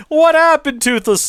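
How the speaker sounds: hammed-up horrified yelp at the dragon; Hiccup, shocked. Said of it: "when Hiccup is like".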